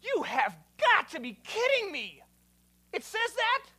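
loud, high-pitched incredulous outburst; exasperated astonishment, punched hard; batty disbelief. Retold or imitated. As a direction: exclaim it like you can't believe what you just read. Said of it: "this drives me batty, where I read something and I'm like".

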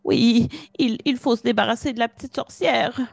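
put-on pleading young villager; young man voice. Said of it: "Et le jeune homme va dire".

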